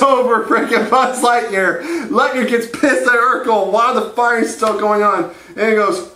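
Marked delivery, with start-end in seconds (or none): none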